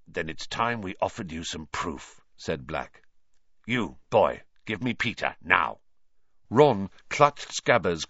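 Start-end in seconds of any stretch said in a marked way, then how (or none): 0.1-5.8 low, rough convict voice. Acted. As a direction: growl it low and tired.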